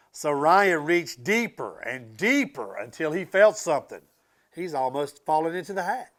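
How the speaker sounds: building storybook narration; rising excitement in the read-aloud; reading to children. A narrator's reading. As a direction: read it to wide-eyed kids. Reading picture book aloud.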